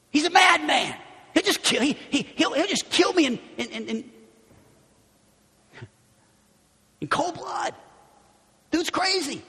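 high, frantic alarm; panicked. Acted. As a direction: blurt it out in panic. Voicing panicked Samuel.

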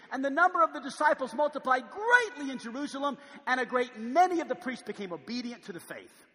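loud, high preacher's build; preaching. Acted. Pastor preaching evangelism to the church, loud and high. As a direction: preach it out to the congregation.

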